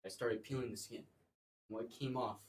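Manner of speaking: normal talking voice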